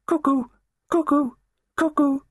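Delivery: loud, definite high-pitched cuckoo; cuckoo clock call. A narrator's reading. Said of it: "announced loudly and definitely".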